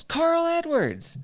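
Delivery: loud, high, drawn-out holler; retold yell. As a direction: holler it like an excited lady at a drive-thru. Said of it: "and she yells".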